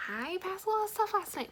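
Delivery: exasperated repeat. Retold or imitated. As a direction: push it out like saying it for the third time. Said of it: "for the third time I told someone".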